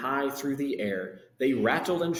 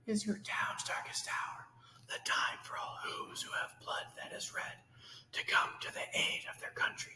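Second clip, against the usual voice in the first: urgent hushed whisper; 10 semitones higher, 9 dB quieter than usual. Acted. Mayor urging the town, in a whisper. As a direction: whisper it like a secret call to arms. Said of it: "he said".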